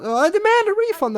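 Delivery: high, loud demanding yell. Retold or imitated. Recording a line, yelling a refund demand loudly. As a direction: bellow the complaint. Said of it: "when I'm yelling out".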